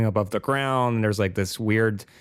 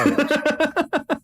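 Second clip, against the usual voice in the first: much higher, slower squeaky voice; high falsetto. Pretend. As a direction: pitch it way up for a joke. Comic repeat in a high voice.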